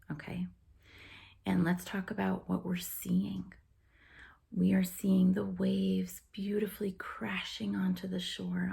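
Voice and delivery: soft, hushed relaxation voice with long pauses; guided meditation. Real, not acted. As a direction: ease listeners gently into a calm beach scene, softly. Leads a guided imagery relaxation.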